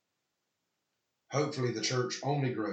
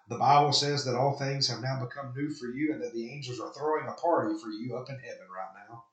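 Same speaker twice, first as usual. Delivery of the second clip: ordinary talk, nothing marked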